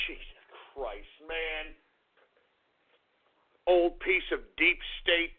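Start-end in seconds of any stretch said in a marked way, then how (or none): 0.0-1.7 breathy laugh through the words, much softer. Real, not acted. Host laughing at the news he shares, soft laughing curse. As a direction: chuckle it out quietly under the breath. Laughing at his own rant.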